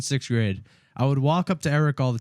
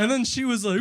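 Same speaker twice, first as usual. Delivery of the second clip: normal talking voice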